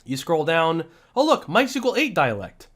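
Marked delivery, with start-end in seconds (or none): 1.2-2.7 raised, excited discovery; worked-up eureka; hyped up. Real, not acted. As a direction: pounce on the find, hyped up. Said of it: "I'm getting hyped up here".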